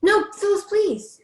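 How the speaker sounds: high, loud plea to stay; pleading call. Real, not acted. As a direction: call after her, begging. Pleading as she leaves.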